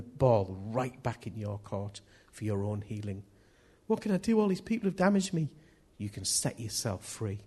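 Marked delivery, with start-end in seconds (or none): 3.9-5.5 higher-pitched mock helpless plea; plaintive complaint. Hurt person complaining about those who damaged them, plaintive. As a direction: plead it higher, as someone helpless.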